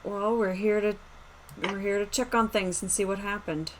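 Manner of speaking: ordinary delivery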